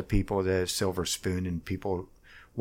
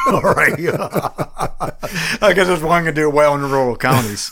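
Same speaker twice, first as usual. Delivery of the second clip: laughing; 8 semitones higher, 9 dB louder than usual. Real, not acted. Laughing at his own blunder.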